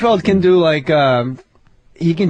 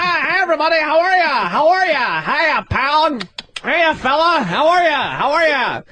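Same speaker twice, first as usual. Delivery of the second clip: higher, chummy stage greeting, over and over; 6 semitones higher than usual. Retold or imitated.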